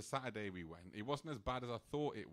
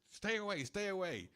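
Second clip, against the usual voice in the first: higher, louder order to keep back; mock stern shout; 8 semitones higher, 4 dB louder than usual. Sadhu guarding the Maharaj, mock stern shout. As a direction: bark a warning to keep away. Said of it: "He goes".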